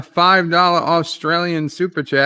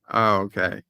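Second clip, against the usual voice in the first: ordinary delivery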